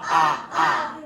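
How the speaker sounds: "ah" as a round open vowel; short o "ah" repeated; short o demo. Shown as a demonstration. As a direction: drop the jaw for "ah, ah". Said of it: "And O says ah".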